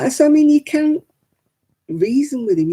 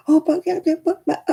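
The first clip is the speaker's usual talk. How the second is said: much higher, flustered mock protest; 12 semitones higher than usual. Mocking the daughter-in-law's worried protest, high. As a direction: mimic a worried mother dithering.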